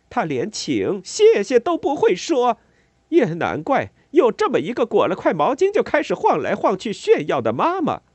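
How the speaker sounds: high, loud, pained rage pouring out; anguished furious tirade. A narrator's reading. Mother raging at the neighbour over her son. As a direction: let the pain and anger flood out. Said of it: "仿佛一股痛苦、愤怒、挣扎的洪流".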